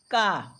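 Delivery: "ka" drawn out and falling; letter sound demo. Shown as a demonstration. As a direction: sound out "ka" as a teacher. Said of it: "इसका उच्चारण कैसे करेंगे".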